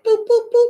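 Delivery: high-pitched cartoon censor bleeps; mock bleep. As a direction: bleep out the swear words. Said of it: "you putting cuss words".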